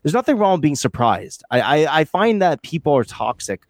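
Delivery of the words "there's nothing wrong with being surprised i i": ordinary talk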